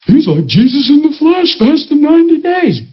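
mock gushing admiration, pitched high; awestruck church. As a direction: marvel loftily like a dazzled congregation. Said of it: "And then the church went".

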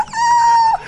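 very high shouted ah; imitated cry. Preacher imitating the girl's overjoyed cry, very high. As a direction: let out a child's overjoyed cry.

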